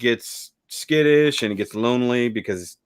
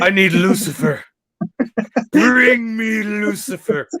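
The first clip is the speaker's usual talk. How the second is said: high, drawn-out imperious demand; hammed-up summons, stretched slow and high; Artesia; 9 semitones higher, slower than usual. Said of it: "Artesia has spoken".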